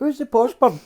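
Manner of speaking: high, curious mock voice; asking like a nosy mate. Retold question, far voice.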